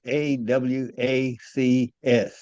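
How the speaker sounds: letters spelled out very slowly; slow spelling. Shown as a demonstration. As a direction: repeat the spelling slowly for someone taking notes. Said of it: "can you spell it once again slowly".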